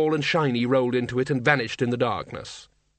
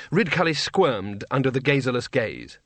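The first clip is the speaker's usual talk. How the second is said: ordinary delivery, nothing marked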